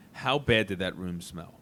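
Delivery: ordinary talk, nothing marked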